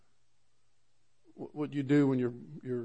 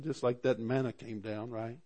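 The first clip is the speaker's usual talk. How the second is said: usual speaking voice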